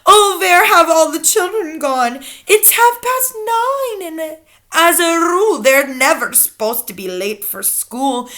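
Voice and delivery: high, loud, drawn-out bewildered cry; theatrical wail of confusion. A narrator's reading. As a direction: call out as a flustered teacher. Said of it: "The teachers cry".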